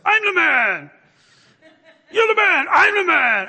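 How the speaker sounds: high, loud chanted shouts; boy yelling back. Retold or imitated. Boy and dad trading shouts walking to the ball, loud high yells. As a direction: yell it back and forth, full voice. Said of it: "And he goes".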